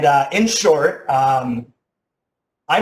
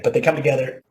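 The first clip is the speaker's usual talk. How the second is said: normal talking voice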